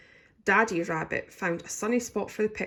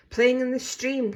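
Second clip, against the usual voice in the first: cried; 6 semitones higher, 5 dB louder than usual. A narrator's reading. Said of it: "cried Archie and Sam".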